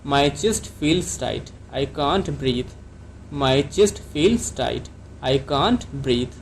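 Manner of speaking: each word drawn out for learners; very slow classroom dictation; very slow teaching model. Shown as a demonstration. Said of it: "तो आप doctor को कैसे कहेंगे English में?".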